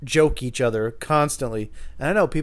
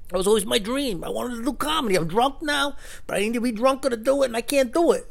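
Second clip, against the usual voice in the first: imitating the drunk guy; 10 semitones higher than usual. Voicing the drunk guy's lament.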